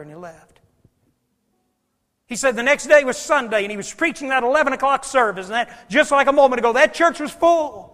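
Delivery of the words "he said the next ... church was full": loud, high preacher's delivery, building to a shout; rising pulpit fervor; full-force preaching. Real, not acted. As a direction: preach it out, driving harder and higher.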